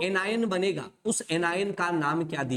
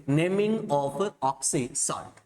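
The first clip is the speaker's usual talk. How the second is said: dictating slowly; 2 semitones lower, much slower than usual. Shown as a demonstration. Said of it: "किसकी बात करेंगे?".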